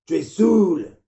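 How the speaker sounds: furious high bellow of a father; father yelling. Acted. Father yelling at his drunk son at the door. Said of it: "a gueulé mon père".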